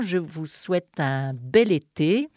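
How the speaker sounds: ordinary talk, nothing marked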